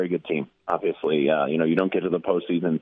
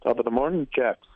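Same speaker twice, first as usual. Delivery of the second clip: put-on a light British accent, a bit higher; mock British greeting; 5 semitones higher than usual. Guest answers the soccer intro in a mild mock British accent. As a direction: greet them like a cheery Englishman. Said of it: "See, he knows".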